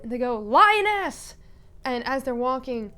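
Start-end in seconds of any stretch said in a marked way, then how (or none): 0.4-1.3 high, loud shouted hail; calling out. Acted. People up ahead shouting back to Odette, calling out. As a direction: holler it across a distance.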